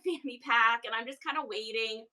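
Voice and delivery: usual speaking voice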